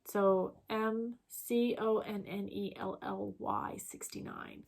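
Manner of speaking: ordinary talk, nothing marked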